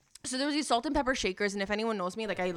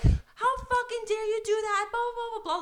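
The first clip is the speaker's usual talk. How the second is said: high, loud outrage trailing into blah-blahs; angry tirade; 12 semitones higher, 4 dB louder than usual. Retold or imitated. Friend pounding on the guy, high outraged rant. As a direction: mimic a furious friend laying into someone. Retold friend yelling at the man.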